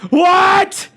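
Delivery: high, rising shout of disbelief; loud disbelief. Real, not acted. Streamer realizing they missed a day, incredulous shout.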